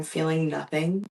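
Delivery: ordinary delivery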